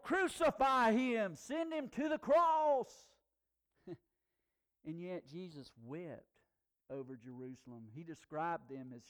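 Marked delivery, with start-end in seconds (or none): 0.0-3.1 much higher, louder, slow chanted shout; mob yelling. Retold or imitated. Preacher voicing the crowd, shouting crucify. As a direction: bellow it like an angry crowd. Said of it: "They yelled".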